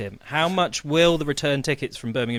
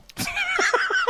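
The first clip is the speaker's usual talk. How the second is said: high squeal of laughter. Real, not acted. Laughing at the big guess.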